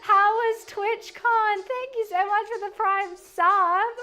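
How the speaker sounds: drawn-out greeting. Real, not acted. Greeting a chatter sweetly.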